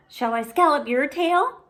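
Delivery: little sister wanting her turn; eager little sister. Acted. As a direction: offer it brightly. Ivy eager.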